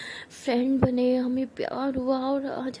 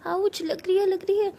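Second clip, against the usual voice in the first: mock pain; 7 semitones higher than usual. Meera hurt by slaps, whining.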